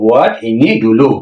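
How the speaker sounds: ordinary delivery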